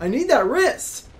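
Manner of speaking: high, loud hammed-up wail of a wounded pianist; mock panicked boss. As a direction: squeal it like a diva who broke her wrist. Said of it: "She's like".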